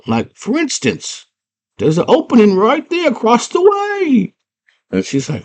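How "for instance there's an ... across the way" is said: very high squeaky worm; tiny worm voice. Retold or imitated. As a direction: chirp it like a tiny creature. Worm points out opening.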